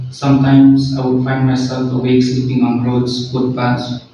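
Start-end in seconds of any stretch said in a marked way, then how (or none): none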